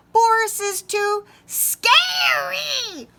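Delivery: high, slow, loud squeak; chorus of squeaking mice; squeaky mice. Acted. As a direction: squeak it together like frightened mice. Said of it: "they all squeaked together".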